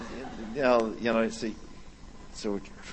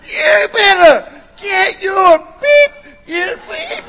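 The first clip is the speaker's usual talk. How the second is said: high, loud, drawn-out dad yell; hammed-up angry parental shouting; shouting like father; 9 dB louder, slower than usual. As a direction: bellow it like a dad losing his temper. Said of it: "I can hear my father coming out".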